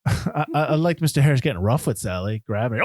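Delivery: ordinary talk, nothing marked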